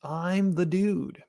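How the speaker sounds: slow, laid-back Dude voice. Retold or imitated. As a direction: drawl it like the Dude. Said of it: "Big Lebowski quote".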